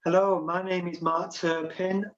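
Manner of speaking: ordinary delivery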